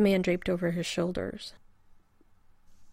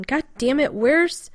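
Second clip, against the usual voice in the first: loud, higher angry curse; frustrated outburst; 7 semitones higher, 8 dB louder, slower than usual. A narrator's reading. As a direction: explode in frustration. Cursing, searching for the doctor.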